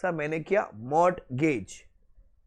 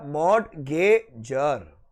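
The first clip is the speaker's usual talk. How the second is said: slow, stretched मॉर्टगेजर spelled out; teacher drawing the word out; drawn out slowly; 3 semitones lower, much slower than usual. Shown as a demonstration. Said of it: "क्या बोलोगे आप?".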